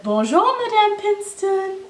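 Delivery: high, loud sing-song hello; cheerful lion hello. Acted. Lion greeting Monsieur Dupont, high and loud. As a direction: call it out happily across the street.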